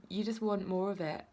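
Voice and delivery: usual speaking voice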